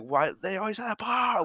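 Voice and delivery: high-pitched mock scream, volume held back; imitated held scream. Showing how the singer ended the song, imitated scream. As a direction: imitate a singer stretching out the final word. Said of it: "he keeps going and going".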